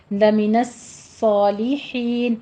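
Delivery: slow, drawn-out Quranic recitation of لمن الصالحین; tajweed demo. Shown as a demonstration. Teacher shows students the heavy صاد, recited slowly. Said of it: "صاد پر پڑھا جائے گی".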